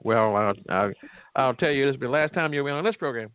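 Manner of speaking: normal talking voice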